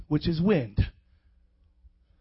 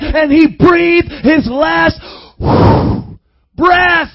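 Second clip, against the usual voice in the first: loud, raised-pitch pulpit shout; full-force preaching; 6 semitones higher, 9 dB louder than usual. Real, not acted. Preacher telling of God's breath and the cross, shouted preaching. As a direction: drive each phrase out at the congregation.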